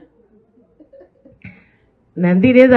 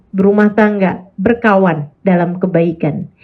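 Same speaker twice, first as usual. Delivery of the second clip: ordinary talk, nothing marked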